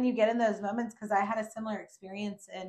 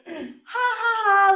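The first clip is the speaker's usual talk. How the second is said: mock carefree laugh; 13 semitones higher, 11 dB louder, much slower than usual. Retold fake carefree laugh.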